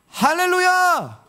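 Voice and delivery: high, long-drawn hallelujah call; praise call-out. Shown as a demonstration. Preacher leads congregation to raise hands, praise call-out.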